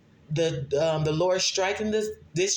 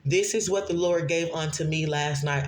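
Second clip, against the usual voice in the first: ordinary talk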